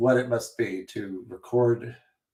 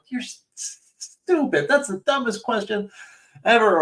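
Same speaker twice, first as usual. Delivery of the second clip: retold screaming at screen; 9 semitones higher than usual. Editor berating their own recorded questions, mock screaming. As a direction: stutter into a high, self-berating mock scream. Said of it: "I would just start screaming at the screen".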